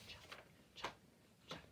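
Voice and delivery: breathy whispered chuck chuck; soft whispered rhythm chant; whispered train chug. Shown as a demonstration. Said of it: "Keep that beat going".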